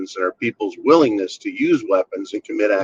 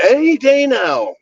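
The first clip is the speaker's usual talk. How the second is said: much higher, slow hammed-up panic; a mocking panicky warning; mock alarm; 4 dB louder than usual. Mocking the warning about Iran with a much higher, slower panicky voice.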